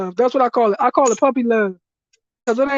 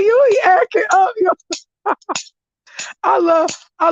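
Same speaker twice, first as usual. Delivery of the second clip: higher, louder quote through laughter; laughing; 8 semitones higher, 3 dB louder than usual. Retold or imitated. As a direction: spit the line out while cracking up. Retelling boyfriend's reply, laughing.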